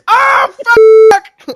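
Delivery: high, loud howl of frustration; hammed-up retold scream; frustrated scream. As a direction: scream the curse out. Acting out dying again.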